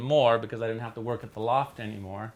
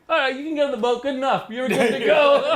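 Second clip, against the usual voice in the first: laughing approval; 11 semitones higher, 7 dB louder than usual. Retold or imitated.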